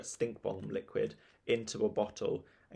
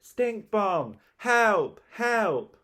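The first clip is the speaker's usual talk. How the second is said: high, panicked cries for help; acted screaming alarm; shrieking; 11 semitones higher, 6 dB louder, much slower than usual. A narrator's reading. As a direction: shriek each cry far above normal pitch. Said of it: "shrieked sour Susan".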